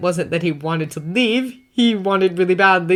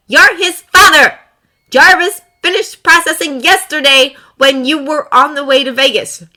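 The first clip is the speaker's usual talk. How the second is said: much higher, much louder yelling; furious shout; 10 semitones higher, 11 dB louder than usual. Acted. Potts shouting at Tony and Jarvis, furious yell. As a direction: shout him down.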